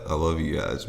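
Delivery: usual speaking voice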